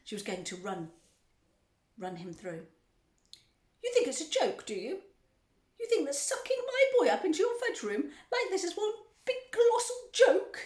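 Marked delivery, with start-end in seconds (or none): 3.8-10.6 high, loud scolding; outraged tirade. A narrator's reading. Mother berating factory owner over the joke, outraged tirade. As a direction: berate loudly, climbing to a screech.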